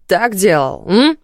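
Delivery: громкая насмешка с подъёмом на «м»; поддразни жестоко; издевательски. Acted. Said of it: "Издевался он". (издевательским тоном)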